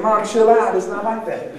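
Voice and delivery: acting out an exasperated son; exasperated protest. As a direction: cut through mom's drama with a loud plea. Son calming his melodramatic mom.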